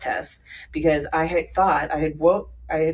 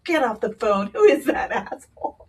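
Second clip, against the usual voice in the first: higher, louder indignant mother; mock angry mom; 6 semitones higher, 3 dB louder than usual. Mom in the car, furious at the boss on the phone. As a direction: play the mother outraged, half-laughing. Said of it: "My mom's all".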